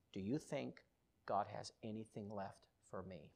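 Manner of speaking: low, very soft, halting; tentative quiet question. Retold or imitated. Dad asking his son about God, tentative quiet question. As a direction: ask it softly, pausing midway. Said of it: "And he said".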